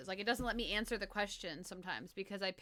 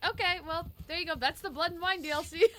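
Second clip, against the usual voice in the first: mock dismissive game voice; 8 semitones higher, 7 dB louder than usual. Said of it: "They're like".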